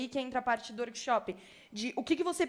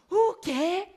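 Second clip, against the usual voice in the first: high, loud startled cry; shocked "O quê?"; 6 semitones higher, 8 dB louder than usual. Retold or imitated. Finding a Bible in the art school room, high startled cry. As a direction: blurt it out in disbelief.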